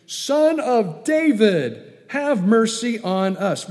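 high, loud, drawn-out call; crying out. Retold or imitated. Two blind men following Jesus, crying out. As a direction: call out slowly for mercy. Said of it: "crying out".

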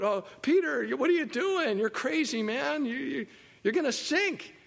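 mock Peter from the safety of the boat; scoffing disciples. Preacher voicing disciples mocking Peter from the boat.

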